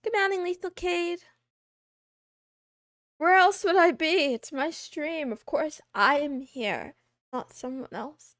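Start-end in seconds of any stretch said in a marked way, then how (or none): none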